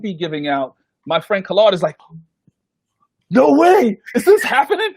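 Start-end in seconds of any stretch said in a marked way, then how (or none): 3.3-4.9 high, loud, laughing disbelief; excited disbelief. Retold or imitated. Friend Khalad stepping off the elevator, laughing and high with excitement. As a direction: squeal it like you can't believe it.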